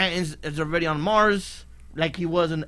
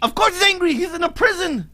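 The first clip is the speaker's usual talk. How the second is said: heated retort; 11 semitones higher than usual. Real, not acted. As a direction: shut down his co-host's point. Heated argument over a show.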